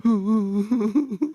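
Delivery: imitated wife's weeping; high hoo-hoo fake sob; hammed-up crying noise; mock sobbing. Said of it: "Nangis dia".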